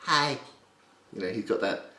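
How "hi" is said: higher cartoon "hi"; high-pitched little greeting; Stewie Griffin. Shown as a demonstration. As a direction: lift it into a high character voice. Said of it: "not very, like".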